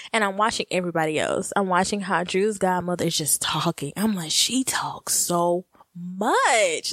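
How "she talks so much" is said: very slow, drawn-out words; stoned amazement. Retold or imitated. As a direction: stretch every word in dazed disbelief.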